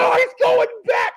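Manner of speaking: very high squealing shout; retold excited yell. Watching the beatdown, shrieking with joy. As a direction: scream it as the wrestler goes back. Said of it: "It's like".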